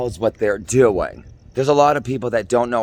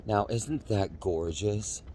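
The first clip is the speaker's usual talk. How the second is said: hushed awe; 2 semitones lower, 11 dB quieter, slower than usual. Real, not acted. Hushed admiring the sky.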